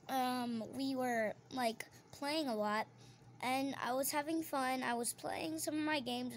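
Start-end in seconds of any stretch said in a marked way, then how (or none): none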